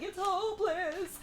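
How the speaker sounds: high, drawn-out weepy wail; slow, high fake sob; mock crying. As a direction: imitate a despairing princess, pitched high. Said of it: "she's out there crying".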